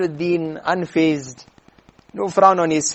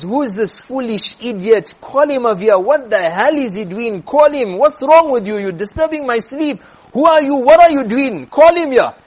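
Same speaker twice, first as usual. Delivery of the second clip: high, loud angry demands; indignant outburst, voice pitched up; raging; 5 semitones higher, 4 dB louder than usual. Acted. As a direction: shout like a furious king woken from sleep. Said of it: "he's raging".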